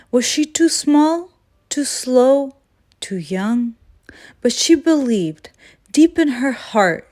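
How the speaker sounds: ordinary delivery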